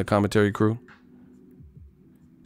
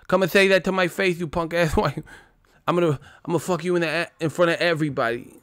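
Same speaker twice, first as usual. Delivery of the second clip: higher, heated threat voice; Tyson threat; 6 semitones higher than usual. Retold or imitated. Comic voices Tyson threatening a reporter, high and heated. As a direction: taunt a reporter like a young Tyson.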